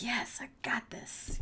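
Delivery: slow, breathless whispered self-pep; psyched-up whisper. Acted. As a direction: whisper it to yourself like a secret pump-up. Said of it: "Be like".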